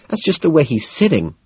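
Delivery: high-pitched exasperated protest. A narrator's reading. Evelyn defending a man to her date, exasperated. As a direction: protest it, fed up with arguing. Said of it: "she says, exasperated".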